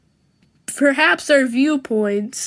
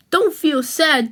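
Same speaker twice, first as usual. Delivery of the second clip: normal talking voice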